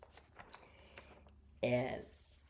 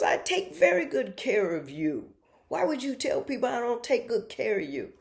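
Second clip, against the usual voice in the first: high-pitched, raised-voice protest; mock offended son; 10 semitones higher, 4 dB louder than usual. Son defending how he cares for mom, loudly offended. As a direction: act out a wounded son protesting. Retold quarrel with her son.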